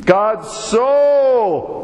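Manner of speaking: God SO, the so lifted high with force; emphatic so. Shown as a demonstration. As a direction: throw the arms wide and hit so. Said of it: "the long arms are a good illustration".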